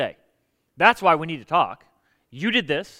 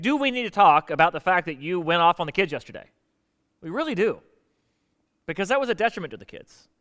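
normal talking voice

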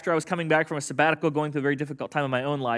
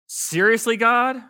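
incredulous seriously, pitched up; exasperated disbelief; 6 semitones higher, 4 dB louder, much slower than usual. Shown as a demonstration. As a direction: ask God in disbelief. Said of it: "Okay, that's what you're gonna do".

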